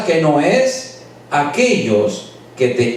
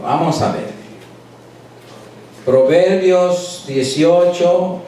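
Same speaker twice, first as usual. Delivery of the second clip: usual speaking voice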